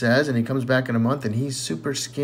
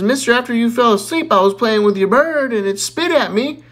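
put-on child voice; 7 semitones higher, 5 dB louder than usual. Johnny confesses to man.

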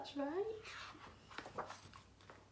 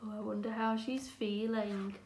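plain reading voice

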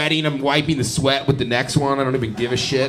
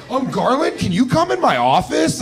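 imitating the manager; 11 semitones higher, 5 dB louder than usual. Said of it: "the guy's like".

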